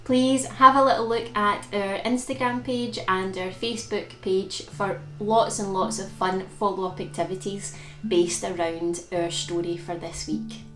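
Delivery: ordinary delivery, nothing marked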